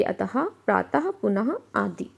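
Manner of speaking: अतः, प्रातः, पुनः with a breathy h at the end; visarga examples. Shown as a demonstration. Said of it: "इसको ह की तरह बोला जाता है".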